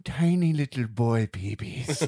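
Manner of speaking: slow, drawn-out imitated request; creepy client. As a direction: stretch each word as the unsettling client.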